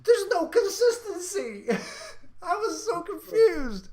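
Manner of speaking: laughing through the words, slow and high. Real, not acted. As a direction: crack up while saying it. Laughing at absurd movie.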